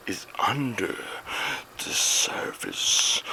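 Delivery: slow dramatic whispered trailer narration; movie trailer voice. Pretend. Vlogger doing a spooky movie trailer, slow and hushed. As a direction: intone it like a mysterious trailer.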